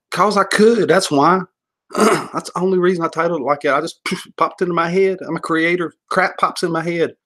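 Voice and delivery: high-pitched, indignant self-justification; mock defensive. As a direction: protest like you're being unfairly questioned. Mock defence against imagined challenge.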